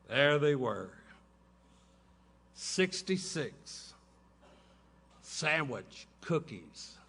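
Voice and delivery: ordinary talk, nothing marked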